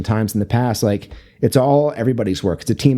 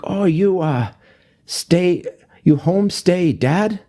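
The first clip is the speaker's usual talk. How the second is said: higher, halting mock German accent; feigned German accent; 8 semitones higher, much slower than usual. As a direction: imitate an older woman's thick German accent. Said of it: "like, a German accent".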